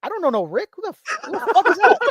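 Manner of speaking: mock-scornful brush-off, climbing high; dismissive disbelief. Acted brush-off of the brother.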